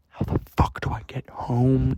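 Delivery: acted anxious whisper; whispered panic. Retelling himself heading to his car, whispered dread. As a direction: whisper it to yourself, at a loss.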